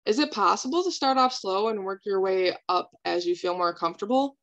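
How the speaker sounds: ordinary talk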